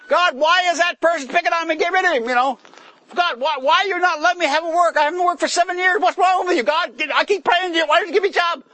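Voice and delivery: high, loud exasperated complaint to God; frustrated prayer. Retold or imitated. Jobless believer complaining to God, high loud exasperation. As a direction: rant at God like a jobless man fed up. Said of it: "you're saying".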